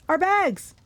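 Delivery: high cry of dismay; sudden realization. A narrator's reading. Grover realizing what was left behind, high exclamation. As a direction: exclaim it as it dawns on you. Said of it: "Grover realized".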